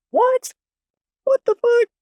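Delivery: very high-pitched, mock-stunned squeak; shocked disbelief. Patient reacting to doctors cursing at her, shocked high squeal.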